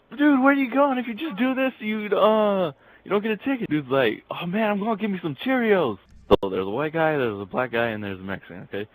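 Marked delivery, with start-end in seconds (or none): 0.1-3.7 high-pitched mock shout after someone; yelling. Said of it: "He yells". 4.3-6.0 high-pitched mock excitement; calling back excitedly. Runaway man calls back.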